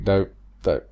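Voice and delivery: ordinary talk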